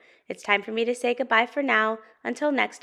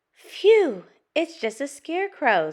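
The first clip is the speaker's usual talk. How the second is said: high, loud phew easing into relief; big relieved exhale, then high and light; relieved Pete; 7 semitones higher, 6 dB louder than usual. Acted. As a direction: voice a relieved young cat, pitched up.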